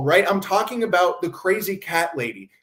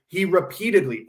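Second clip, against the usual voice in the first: ordinary talk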